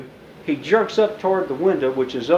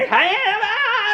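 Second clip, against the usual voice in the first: alarmed shouts; slower than usual. Retold or imitated. Officers shouting.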